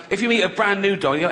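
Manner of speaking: ordinary talk, nothing marked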